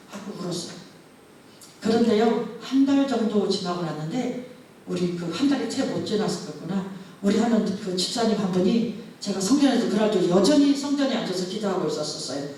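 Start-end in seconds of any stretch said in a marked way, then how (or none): none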